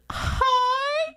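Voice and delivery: very high, squeaky hello; mock starstruck. Imitating her friend greeting her idol, squeaky mock starstruck. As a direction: hold a tiny high squeal.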